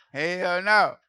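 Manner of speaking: hell no, high and very loud; shouted hell no. Retold or imitated. Comedian shouting at the crowd, high and loud. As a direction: yell it out in disbelief. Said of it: "I said".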